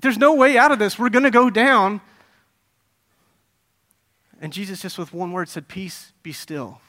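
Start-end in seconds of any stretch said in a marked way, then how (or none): 0.0-2.0 high, loud panicked cry; acting out doomed sailors in alarm; mock panic, raised and loud; freaking out. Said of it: "That's why they were freaking out". 5.7-6.8 slow, very soft order; quiet and unhurried calm; hushed command. Acted. Jesus quietly commanding the storm.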